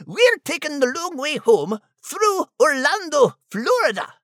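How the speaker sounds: put-on Scottish accent. Scottish character Max.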